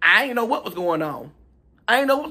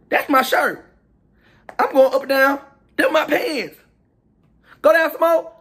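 rising outrage; 7 semitones higher, 4 dB louder, slower than usual. Retold or imitated. Spotting his stolen clothes online.

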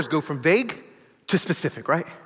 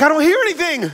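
high, louder mock protest; exasperated, voice pitched up; stressed out; 7 semitones higher, 4 dB louder than usual. Friend stressed about hearing from God, high exasperated protest. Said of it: "he was so stressed out".

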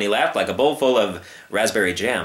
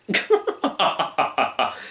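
wheezy laugh; 7 semitones higher than usual. Acted. Circus man laughs at own joke.

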